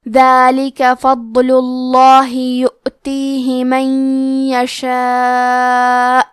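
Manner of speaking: very slow, chanted Quran recitation; slow, measured tajweed recital. Shown as a demonstration. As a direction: recite each verse in long, measured, melodic phrases. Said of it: "تلاوت تجوید کے ساتھ سماعت فرمائیں".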